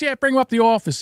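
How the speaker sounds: easygoing doctor on the phone; doctor friend. Retold or imitated. Family doctor friend agreeing to stitch the boy, lightly voiced casual. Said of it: "Doctor Venanzi says".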